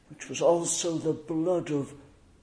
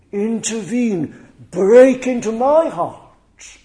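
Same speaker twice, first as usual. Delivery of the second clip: louder, higher cry to God; loud pleading cry; 5 semitones higher, 11 dB louder than usual. Pretend. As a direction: cry out the plea with force. Preacher voices a pleading prayer.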